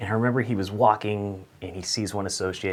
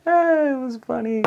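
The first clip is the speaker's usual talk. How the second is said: mock amused. Taunting friends.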